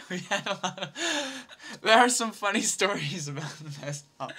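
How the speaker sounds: laughing through the words; can't hold back giggles while telling; laughing at a funny memory. Real, not acted. Laughing recalling mess hall stories.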